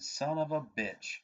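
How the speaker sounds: drawn-out exasperated son of a bitch, slow and pained; exasperated groan. Retold or imitated. Telling the cop about the tail light, mock exasperated. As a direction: groan it like a broken promise. Retold frustration at cop stop.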